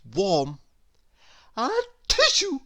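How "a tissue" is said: high sneeze spoken as a tissue; comic sneeze. A narrator's reading. Mister Sneeze sneezes on the path, high comic sneeze. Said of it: "Somebody sneezed".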